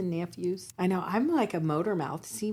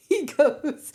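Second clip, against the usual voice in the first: high, loud laugh into the words; laughing, higher and louder; 9 semitones higher, 7 dB louder than usual. Real, not acted. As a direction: laugh at your own joke. Laughing after the joke.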